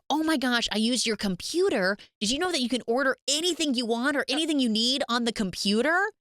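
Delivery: high, eager gushing, played up; acted excitement. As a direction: imitate someone thrilled by a discovery. Acted excited news about online ordering.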